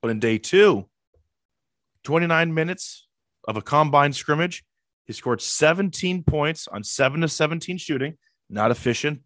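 ordinary talk

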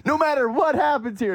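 very high, hammed-up commentator frenzy; Tony Romo losing his mind. Host imitating a frantic game commentator, very high mock excitement. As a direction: squeal it like an overexcited broadcaster. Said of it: "Tony Romo losing his mind".

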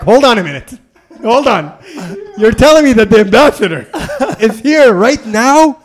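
loud, high, mock-outraged protest with laughter; indignant disbelief. Traveler learning the ambassador is already there, comically indignant disbelief.

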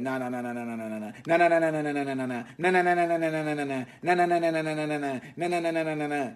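rapid-fire string of nahs; Stephen A interrupting. Retold or imitated. Host mimics Stephen A cutting Molly off, nonstop interrupting. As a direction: rattle it off nonstop to drown someone out. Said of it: "He always be cutting Molly off".

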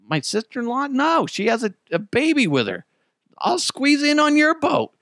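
high-pitched mock pleading; incredulous protest. Acted protest at the boatman.

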